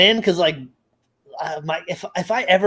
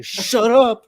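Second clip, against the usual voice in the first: higher, louder mock snap; imitating passionate shut up; 7 semitones higher, 5 dB louder than usual. Friend mimicking the dad's heated shut up. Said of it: "with such passion".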